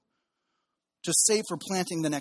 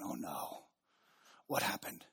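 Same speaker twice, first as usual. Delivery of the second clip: low, hushed dread over bad news; worried; 12 semitones lower, 12 dB quieter, slower than usual. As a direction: brace for the worst quietly. Fearing bad news on phone.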